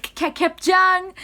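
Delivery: high, loud, stretched-out stutter into a yell. Retold or imitated. Member yells his signature line to fans, loud and high. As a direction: hype the crowd with a drawn-out cry. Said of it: "of course he had to do K-, [name]".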